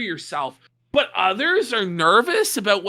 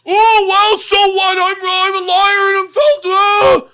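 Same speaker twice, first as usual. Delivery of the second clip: loud, high mock defensive whine; mocking whiny voice; 11 semitones higher, 11 dB louder than usual. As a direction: parody a caught liar, loudly. Mocking the liar's reply.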